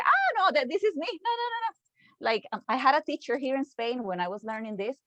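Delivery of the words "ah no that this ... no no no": high-pitched, startled "no, no, no" of someone caught out; shocked recognition. Retold or imitated. Teacher voicing a student who recognizes themselves, shocked and high. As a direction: pitch shoots way up as if the description hit too close. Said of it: "you feel very identified and you say".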